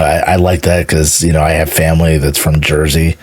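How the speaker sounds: ordinary talk, nothing marked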